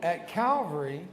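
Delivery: slow, measured phrases with long pauses; solemn sinner's prayer, spoken clearly; led prayer. Real, not acted. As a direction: give each line slowly so the church can repeat it. Leads congregation in repeat-after-me prayer.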